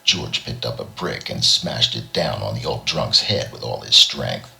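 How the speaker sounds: ordinary delivery, nothing marked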